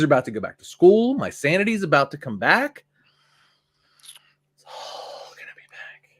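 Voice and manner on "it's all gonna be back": breathy relieved whisper. Real, not acted. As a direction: whisper it like a secret hope. Sanity returning when school starts.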